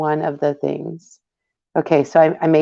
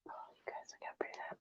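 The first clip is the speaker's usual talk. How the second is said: soft breathy whisper; 32 dB quieter, much faster than usual. Real, not acted. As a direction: hush it, as if not to disturb. Whispering over the project.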